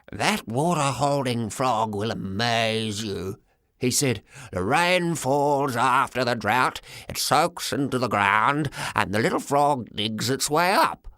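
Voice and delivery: put-on slow, low old man, happy and eager; a gentle hint of an old man's wonder; old grandfather. A narrator's reading. Grandpop explains the frog.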